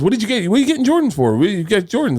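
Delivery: high, incredulous questioning; incredulous Kevin. Retold or imitated. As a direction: ask like you can't believe it. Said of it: "And he goes".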